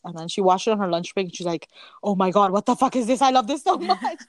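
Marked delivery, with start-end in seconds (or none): 2.0-4.2 rising excited gush. Retold or imitated. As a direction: gush with delight. Friend voiced loving it.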